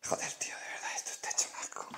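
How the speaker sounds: hushed whisper of disgust. Real, not acted. To viewers about the messy room, a whisper. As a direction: whisper it, voice fully unvoiced.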